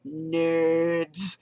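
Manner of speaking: a touch of mock-serious deadpan. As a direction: drop Nerds dry and straight-faced. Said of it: "looks at us super seriously and goes".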